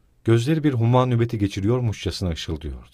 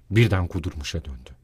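ordinary delivery, nothing marked

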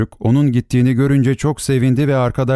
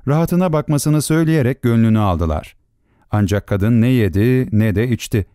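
ordinary delivery

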